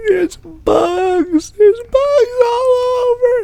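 very high, tearful wail; hammed-up imitation of a panicking daughter; shrieky mock crying, dragging out the words; mock freak-out. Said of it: "she's freaking the fuck out".

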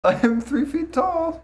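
very high, slow squeak; tiny man voice. Pretend. Playing tiny Shakespeare.

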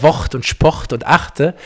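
"Wort und Sport" with r hardened to ch, "Wocht und Spocht"; East Belgian r. Shown as a demonstration. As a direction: rhyme "Wort" and "Sport" with a harsh ch. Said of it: "der Ostbelgier sagt ja". („Wort“ und „Sport“ ostbelgisch mit hartem ch)

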